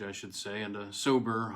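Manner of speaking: ordinary delivery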